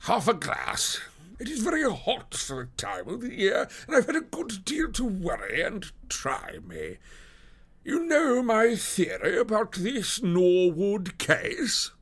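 high-pitched, slow character voice for a harried policeman; stretched-out, high voice of a troubled officer; police detective with airs, put-upon. Acted. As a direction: voice a worried detective, pitched far above your own.

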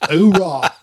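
loud, punchy hoorah; mock Marine cry. Telling the host about the Marine Corps, loud hoorah. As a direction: bark it like a Marine.

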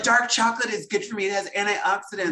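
high, smug justifying voice; mock excuse voice. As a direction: airily justify the treat. Mocking people's excuses.

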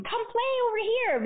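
put-on high child's call across the yard; sing-song invitation to play. Child calling kids across driveway.

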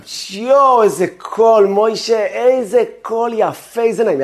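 high, loud, delighted relatives gushing; gushing praise. Retold or imitated. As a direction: rave over the boy's voice like doting relatives. Relatives praising singing child.

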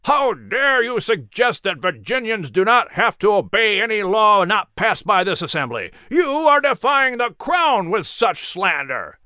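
outraged protest at the speaker; angry shouting. Acted. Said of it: "on their feet shouting".

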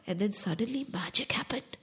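soft unvoiced whisper; mysterious whisper. A narrator's reading. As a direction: hush it like a secret spell. Mysterious story turn.

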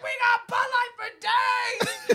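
high loud slow holler, laughing; laughing bartender yell; excited shout. Retold or imitated. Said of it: "She's like".

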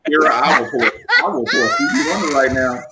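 loud playful threat, half-laughing; mock threat. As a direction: shout the threat jokingly. Joking threat to Kira.

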